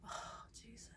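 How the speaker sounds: barely audible, breathless whisper; faint whisper. Retold or imitated. Dizzy patient in the bathroom chair, faint whisper.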